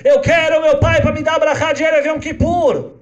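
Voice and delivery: put-on a girl begging for her father; pleading little girl. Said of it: "Ele vai pra essa menina e fala". (menina suplicando, aguda, alta e lenta)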